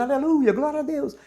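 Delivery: high, lilting mock praise; imitated fervent worshipper. Congregant praising when mercy is called for, imitated fervor. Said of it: "Tá dizendo".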